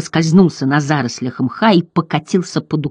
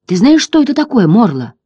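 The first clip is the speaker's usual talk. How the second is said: high, urgent question; the boy pushes the old turtle for an answer, eagerly; 7 semitones higher, 4 dB louder than usual. A narrator's reading. Boy to ancient turtle, holding up amulet, high and eager.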